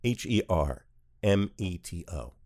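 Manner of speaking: H E R M E T O said letter by letter; spelling it out. Shown as a demonstration. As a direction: spell out H E R M E T O one letter at a time. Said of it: "I'll spell that for you too".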